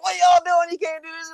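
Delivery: high, yelling at cheating wrestlers; high-pitched scolding as the ref; mock ref yell. Ref yelling at cheating wrestlers, high and mock-stern. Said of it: "I'm like".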